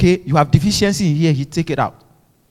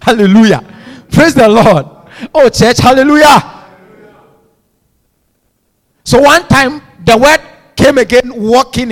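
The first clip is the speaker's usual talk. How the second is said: loud, ringing praise call; preaching; 6 semitones higher, 8 dB louder than usual. Acted. Preacher rousing the congregation, loud and ringing. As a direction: lift the church with praise.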